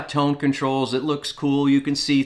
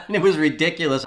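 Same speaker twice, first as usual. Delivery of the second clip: laughing; 3 semitones higher, faster than usual. Real, not acted. Laughing at own teenage ritual.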